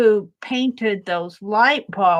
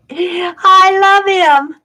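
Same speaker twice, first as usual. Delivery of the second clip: laughing; 9 semitones higher, 7 dB louder, much slower than usual. Real, not acted. Delighted painting the snowman.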